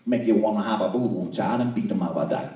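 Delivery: put-on garbled thick New Orleans accent; New Orleans waitress. Waitress answering about the catfish, nearly unintelligible New Orleans accent. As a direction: run the words together in deep Louisiana dialect. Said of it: "And she said".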